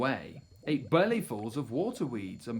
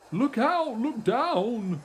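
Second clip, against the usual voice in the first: high, loud alarm; warning yell; 8 semitones higher, 5 dB louder, slower than usual. A narrator's reading. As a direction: shout to stop them in time. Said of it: "who yelled".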